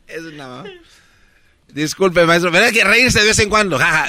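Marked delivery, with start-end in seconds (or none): none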